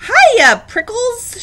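very high, loud hail; shouted greeting. A narrator's reading. Jackrabbit dashing by Hank, shouted greeting. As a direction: shout a teasing nickname in passing. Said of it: "she shouted".

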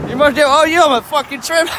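ordinary talk

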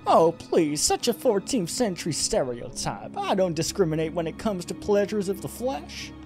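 high, dismissive falsetto woman; scornful villainess. Acted. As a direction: scoff in a put-on high female voice. Voices the succubus Lily.

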